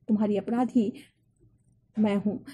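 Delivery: usual speaking voice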